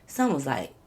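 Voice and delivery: ordinary delivery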